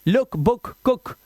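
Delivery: लुक, बुक, कुक each with a brief "u"; short vowel sound. Shown as a demonstration. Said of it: "ये sound है इसका". (लुक, बुक, कुक में छोटा उ)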